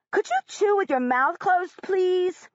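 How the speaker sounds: big, loud, high complaint. Acted. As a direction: say it loud and wide-eyed. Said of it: "so is her voice".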